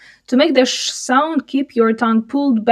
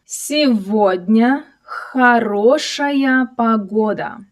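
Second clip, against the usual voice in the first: slow, drawn-out model reading for learners; slower reading; slower than usual. Shown as a demonstration. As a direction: stretch each word out for a learner to follow.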